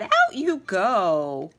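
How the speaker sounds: a small mouse laying down the law; stern squeak; bossy mouse. A narrator's reading. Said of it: "Mortimer said".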